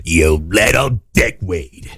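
low, very slow smoker-mom scolding; impression of mom. Cohost mimicking mom at little sister, low drawn-out scolding. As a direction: drag each word out in a deep mother voice. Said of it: "That is exactly how that sounded".

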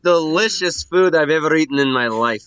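high, loud praise; ecstatic gushing. Acted. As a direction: gush loudly over the meal. Diner gushes to cook.

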